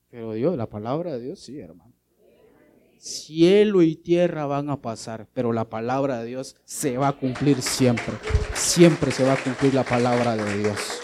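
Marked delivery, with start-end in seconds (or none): 3.0-11.0 louder, emphatic preacher's proclamation; forceful preaching. Acted. Preacher proclaiming to the congregation, emphatic.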